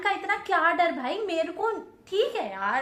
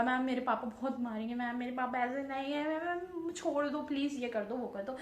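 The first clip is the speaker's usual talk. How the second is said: retold pleading; 7 dB quieter than usual. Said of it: "बिल्कुल मैंने हाथ वाथ सब जोड़ लिए".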